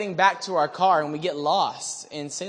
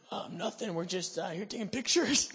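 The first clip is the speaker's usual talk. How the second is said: feigned innocence; 2 semitones higher, 6 dB quieter than usual. Retold or imitated. Caught on the steps by the stranger, feigned innocence.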